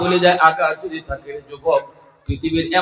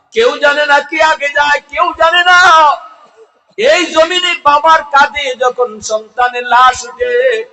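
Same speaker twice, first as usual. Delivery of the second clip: high, loud chanting sermon delivery; ringing, high-pitched lament to a crowd; impassioned preaching; 11 semitones higher, 8 dB louder than usual. Real, not acted. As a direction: preach at full force, pitched far up. Preaches at full force.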